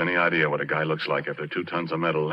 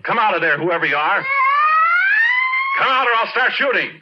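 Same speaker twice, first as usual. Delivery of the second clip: loud, high shouted warning; yelled threat; 9 dB louder than usual. Acted. Porky yelling at the hidden thing on the rig, loud and threatening. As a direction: bellow into the dark, gun ready. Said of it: "and I yelled".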